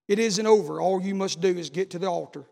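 normal talking voice

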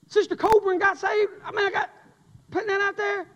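bubbling over with the good news; joyful preacher sharing news; excited telling. Real, not acted. Preacher excited about salvations.

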